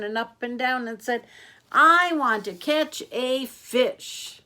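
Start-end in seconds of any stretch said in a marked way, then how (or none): 1.7-4.4 loud, high, drawn-out demand; impatient child. Acted. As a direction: stretch every word in impatience. Impatient girl demands it more slowly.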